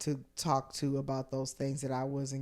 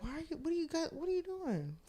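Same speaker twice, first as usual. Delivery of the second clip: squeaky, high disbelieving what are you doing; incredulous high quote; 14 semitones higher, 6 dB quieter, faster than usual. Retold or imitated.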